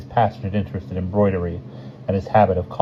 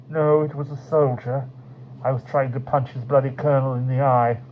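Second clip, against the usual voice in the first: Martins voice; 7 semitones higher than usual. A narrator's reading. Martins voiced, far timbre.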